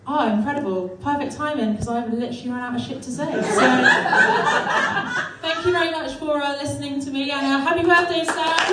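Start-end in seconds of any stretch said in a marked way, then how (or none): 3.5-5.4 high, loud laugh. Real, not acted. Laughing at running out of material.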